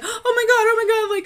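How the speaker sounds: high, loud laughing mock panic. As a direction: parody the freak-out she didn't have. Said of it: "I was not like".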